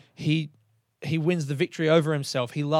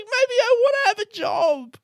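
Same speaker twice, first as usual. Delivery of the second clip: whiny plea; 11 semitones higher, 4 dB louder than usual. Retold or imitated. Pleading inner voice, whiny.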